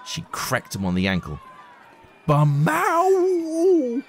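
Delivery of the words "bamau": much higher pained yelp; yelping shout. Acted. Boy yelping after a crack on the ankle. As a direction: yelp in sudden pain. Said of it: "shouted Darius".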